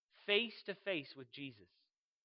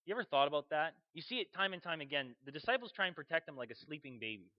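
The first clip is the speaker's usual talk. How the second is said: ordinary talk, nothing marked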